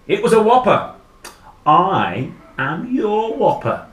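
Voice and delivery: loud, emphatic creature announcement, slowing down; looming monster. Acted. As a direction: introduce yourself as a big monster. Monster creature introduces itself.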